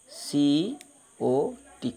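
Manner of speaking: each letter of COT spelled out very slowly; slow spelling. Shown as a demonstration. Teacher spells the word for her pupils, very slowly. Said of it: "দেখতে পেয়েছো?".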